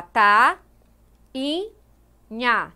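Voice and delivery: "ta, in, nha" in three separate syllables. Shown as a demonstration. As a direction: break it into "ta, in, nha", pausing after each. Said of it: "se você separar a palavra, vai ficar".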